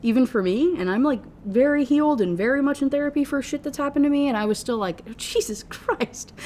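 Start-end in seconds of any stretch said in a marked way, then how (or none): 5.1-6.3 drawn-out, higher, breathless laughing exclamation; appalled laughing. Retold or imitated. Reader recalls her shock at the book, appalled laughing. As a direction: laugh through it in disbelief.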